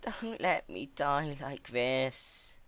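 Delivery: slow, desperate plea; urgent begging. Acted. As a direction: beg for your life. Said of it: "Celestia spoke urgently".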